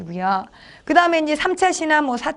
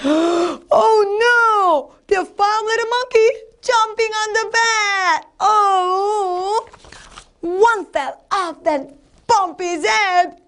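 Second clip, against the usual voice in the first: high, alarmed storybook voice; dramatic storytelling; 8 semitones higher, much slower than usual. Shown as a demonstration. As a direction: gasp and wail in mock dismay for children. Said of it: "이렇게 실감나게 재밌게 해야지".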